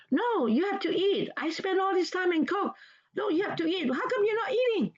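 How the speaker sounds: high, scolding demand to eat; high-pitched exasperated nagging; nagging angry parent. Shown as a demonstration. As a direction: scold them like an annoyed cook whose dinner is refused. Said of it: "you're getting mad at them".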